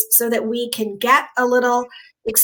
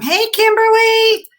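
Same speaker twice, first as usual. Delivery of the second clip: higher, slower delighted hello; excited greeting; 10 semitones higher, 4 dB louder than usual. Real, not acted. Host greets a viewer joining the live stream, delighted.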